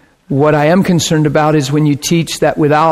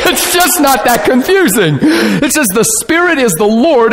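laughing; 7 semitones higher than usual. Real, not acted. Laughing through scripture point.

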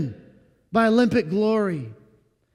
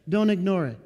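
ordinary delivery

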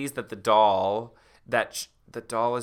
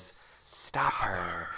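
eerie voice fading to a breathy hiss; ghostly closet voice, soft and eerie; creepy closet voice; 8 dB quieter, much slower than usual. Acted. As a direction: sound like something hidden in the dark. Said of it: "a voice from the closet says".

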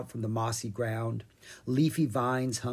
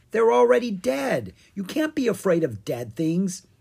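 high, loud disbelief; incredulous; 8 semitones higher, 7 dB louder than usual. Acted. Owen dismisses Ella's fear of zombies, incredulously. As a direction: scoff as if it's absurd.

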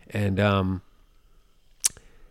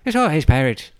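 very high, loud hammed-up Irish lad voice; mock Irish accent; 14 semitones higher, 6 dB louder than usual. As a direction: squeal it like a wee Irish boy. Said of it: "Or maybe he's like".

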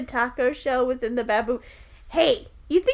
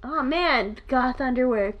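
usual speaking voice

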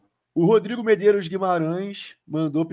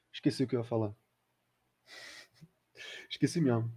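trailing off; 4 semitones lower, 11 dB quieter than usual. Real, not acted. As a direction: voice fading away, forgetful. Forgets his point mid-sentence. (falando baixinho, perdendo o fio)

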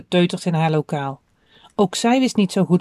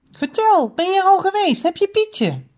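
put-on high-pitched, excited child's questions; eager questioning; 12 semitones higher than usual. A narrator's reading. Boy asking grandpa whether he has the bird, eager. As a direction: press eagerly for the news.

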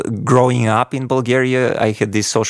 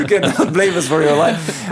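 high, laughing mock warning; amused half-laughing quote; laughing retell; 6 semitones higher than usual. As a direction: chuckle through the parents' joke. Retelling parents' joking warning.

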